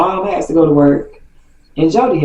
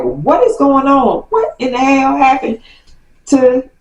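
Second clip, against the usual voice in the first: mock angry aunt; 8 semitones higher than usual. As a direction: demand answers like an upset aunt. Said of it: "my aunt is ringing my mom's line like".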